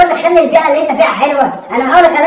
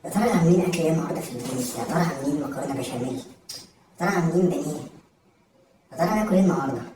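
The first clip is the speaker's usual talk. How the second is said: much lower and softer, lost in thought; daydreaming murmur; 10 semitones lower, 12 dB quieter than usual. Acted. Hungry student daydreaming in class, murmuring. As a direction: murmur dreamily about lunch.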